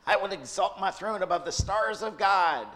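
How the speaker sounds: a vain angel bragging to the sky; Lucifer's voice. Acted. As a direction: grandiose mock Lucifer. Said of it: "that's how he sounds, right?".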